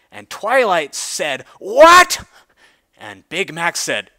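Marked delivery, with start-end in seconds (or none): 1.6-2.2 a sudden high, loud "what"; a startled cry of shock; shocked shriek. Acted. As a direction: yelp it out in disbelief. Twilight shocked by spider babies.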